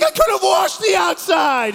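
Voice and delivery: hammed-up dad yelling in disbelief; exasperated dad.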